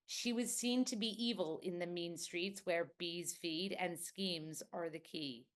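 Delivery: American trigger sentence in an American accent. Shown as a demonstration. Actress showing the host her American trigger sentence, American accent. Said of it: "That's my American trigger".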